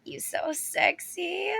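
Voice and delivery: wife sweet-talking an angry husband, whispering; seductive whisper. Retold or imitated. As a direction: coo it to lure him away. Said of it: "she'd be like".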